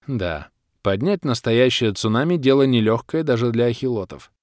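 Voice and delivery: usual speaking voice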